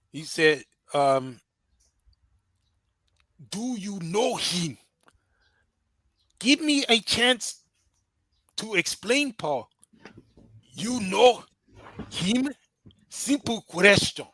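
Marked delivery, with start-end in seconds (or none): 3.5-14.3 slow, insistent mock African accent, pitched up; hammy African accent, pressing for an answer; exaggerated African accent, demanding; bad African accent. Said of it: "go back to our bad African accent".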